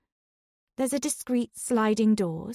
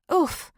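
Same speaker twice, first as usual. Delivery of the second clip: sharp high grunt of impact; knocked breathless oof; winded oof; 8 semitones higher, 3 dB louder than usual. Acted. Narrator bumps into Patrick.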